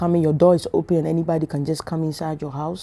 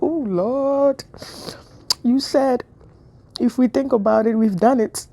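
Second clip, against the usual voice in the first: mock confession; 6 semitones higher, slower than usual. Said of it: "And I'm like".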